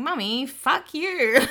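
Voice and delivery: put-on picture the kid saying it in public; imagined toddler. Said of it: "in public going".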